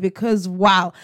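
ordinary talk, nothing marked

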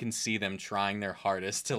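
ordinary delivery

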